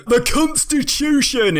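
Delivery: high-pitched caricature American shout; mock American accent. Idiots running around shouting, in a mock American accent. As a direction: cry it out like a ranting American caricature. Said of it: "in that classic American accent I just did".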